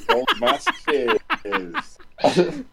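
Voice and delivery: slow, high, laughing through the words. Real, not acted. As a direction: crack up while answering. Laughs while answering a joke.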